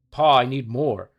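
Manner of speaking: loud, slow demanding yell; sudden shout. Acted. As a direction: bellow it at your father. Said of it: "The sudden shout".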